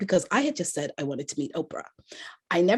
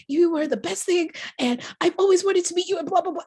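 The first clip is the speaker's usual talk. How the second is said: gushing fan; 12 semitones higher, 3 dB louder than usual. Retold or imitated. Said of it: "I just kept going on".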